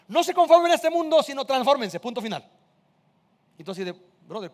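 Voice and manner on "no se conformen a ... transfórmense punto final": high, loud mock divine command; God giving orders. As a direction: hand down a blunt order as God. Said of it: "sería horrible que nada más te dijera Dios". (orden divina fingida, aguda y fuerte)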